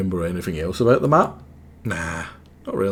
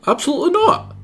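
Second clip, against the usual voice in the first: much higher, loud no; shocked refusal; 5 dB louder, faster than usual. Real, not acted. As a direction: recoil in shock.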